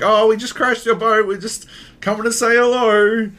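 high, loud broken-English greeting; mock broken-English singsong. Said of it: "it's super broken English".

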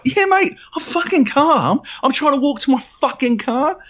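very high, re-enacted screaming outburst; climactic angry yell. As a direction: scream that you are calm, at full pitch. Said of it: "it culminates in".

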